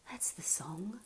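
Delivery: soft breathy whisper; hurt Lila whispering to Leonard. Acted. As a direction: whisper it gently. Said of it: "she whispered".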